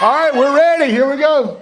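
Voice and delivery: high, loud call to the crowd; rally them like a showman; hyped up. Real, not acted. Presenter filming the screaming crowd, excited call.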